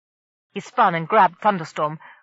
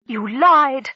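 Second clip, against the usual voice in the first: higher, louder accusing shout; angry shout; 6 semitones higher, 7 dB louder than usual. A narrator's reading. Marina accusing Kef of lying, angry high shout. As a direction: hurl the accusation at him. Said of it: "Marina shouted at Kef".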